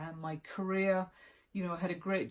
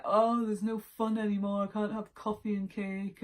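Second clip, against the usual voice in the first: lightly hammed-up little pity party; mock moaning; 4 semitones higher, 4 dB louder than usual. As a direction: imitate your own moan, a bit higher and louder at first. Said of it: "my little moans 'cause I'm like".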